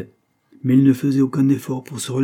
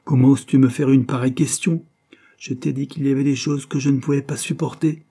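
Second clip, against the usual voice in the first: raised, pitched-up outrage; indignant outburst, loud at the start; 5 semitones higher, 5 dB louder than usual. A narrator's reading. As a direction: protest in angry disbelief. Henry outraged at William's question.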